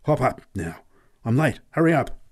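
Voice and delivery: urgent command, rising to a loud finish; urgent, building to a high, loud call. A narrator's reading. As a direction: hurry someone aboard, building in pitch and volume. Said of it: "exclaimed urgently".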